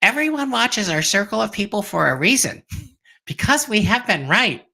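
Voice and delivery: playful high, squeaky impression of a friend; Jen. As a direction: pitch it way up into a small squeak. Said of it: "Should I say it in Jen's squeaky little voice?".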